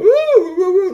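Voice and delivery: high, loud hooting cheer; alien crew whooping. A narrator's reading. Four crew members hail their prince, loud whooping. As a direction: whoop like a whole crew at once. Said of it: "four of them going".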